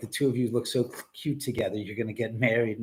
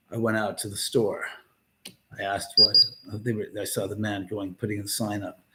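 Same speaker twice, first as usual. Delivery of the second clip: Steven Wright deadpan; 5 semitones lower, 7 dB louder than usual. Retold or imitated. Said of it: "Always just the deadpan".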